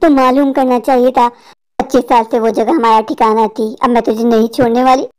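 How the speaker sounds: very low, menacing witch voice; low monotone demon-woman; vengeful witch. Acted. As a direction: deliver the threat as a deep-voiced witch. Witch rejects his excuse.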